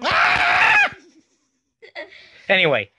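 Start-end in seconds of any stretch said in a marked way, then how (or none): 0.0-0.9 shrill startled scream; high scream. Acted. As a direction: scream out in sudden fright. Sudden fright in the scene.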